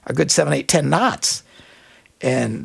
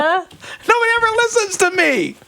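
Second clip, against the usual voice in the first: high, drawn-out hammed-up complaint; mock yelling; 14 semitones higher than usual. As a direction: wail it high, comically fed up. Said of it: "I'd be yelling".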